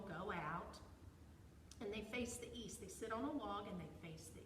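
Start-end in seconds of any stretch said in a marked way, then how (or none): none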